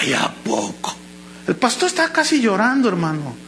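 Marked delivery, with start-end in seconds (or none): 0.0-0.9 breathy hushed "no way"; hushed disbelief. Retold or imitated. Preacher voicing a listener's hushed disbelief. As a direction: gasp it out under your breath in disbelief.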